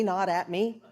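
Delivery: normal talking voice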